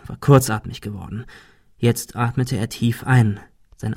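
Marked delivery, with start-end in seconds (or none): none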